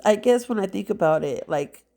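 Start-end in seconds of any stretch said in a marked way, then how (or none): none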